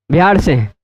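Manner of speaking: imitating the student saying बिहार as बिहाड़; Bihar with a flapped r. Shown as a demonstration. छात्र की नकल में बिहार को बिहाड़ कहना. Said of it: "र को ड़ बोलते हैं".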